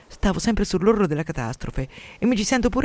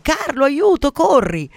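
high, loud cry for help; alarmed scream; 10 semitones higher, 7 dB louder, slower than usual. Acted. Panicked mother calls her husband, high alarmed shout. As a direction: scream for someone to come running. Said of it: "si mise a gridare".

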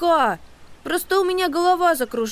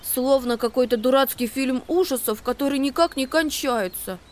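complaining; 8 semitones higher than usual. A narrator's reading. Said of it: "пожаловался он".

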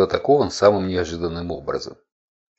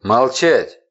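loud, high angry roar; furious bellow; 5 semitones higher, 7 dB louder than usual. A narrator's reading. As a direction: bellow the order down. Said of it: "яростно грянуло сверху".

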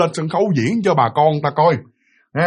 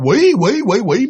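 mild mock yell, a little higher; raised-voice retold cry, repeated quickly; 6 semitones higher, 3 dB louder, slower than usual. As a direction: imitate someone crying out in alarm. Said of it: "mẹ la lên như vậy đó".

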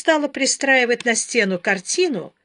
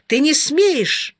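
higher, slow mock yell; indignant shout; 6 semitones higher, 4 dB louder, much slower than usual. A narrator's reading. Husband forbidding his laughing wife, indignant raised voice. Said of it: "закричал он на неё".

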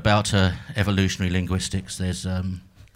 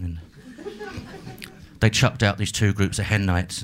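ordinary talk, nothing marked

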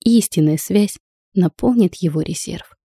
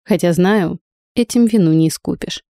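plain reading voice

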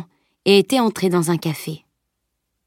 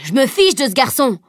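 higher, angry outburst; flaring up; 6 semitones higher than usual. Acted. Driver losing patience with the stranger, angry outburst. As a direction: snap back in a temper. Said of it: "s'emporta le conducteur".

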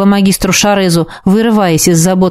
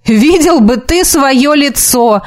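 drawn-out, higher-pitched gleeful jeer; amused exclamation; 5 semitones higher, slower than usual. A narrator's reading. The dark mage teases Антор after laughing, amused exclamation. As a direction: exclaim slowly, relishing the other's shock. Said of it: "воскликнул он".

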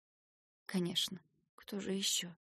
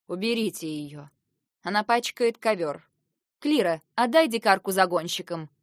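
imperious order; 7 dB louder than usual. A narrator's reading. Princess orders captive removed.

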